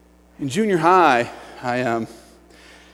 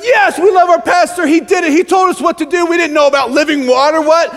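high, loud mock excitement; hammed-up crowd cheering its pastor; adoring congregation; 8 semitones higher, 4 dB louder than usual. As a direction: gush as a whole hyped-up church. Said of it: "the church is like".

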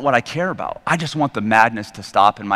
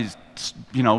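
ordinary delivery

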